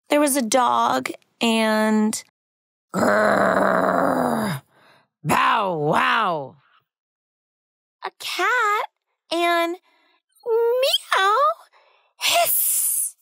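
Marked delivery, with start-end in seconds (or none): 2.9-6.6 growling then barking like a dog; growl, then a gruff bow wow. Acted. Dog noises in story. 8.0-13.2 high meow, then a breathy hiss; cat meow and hiss. Acted. Cat noises in story.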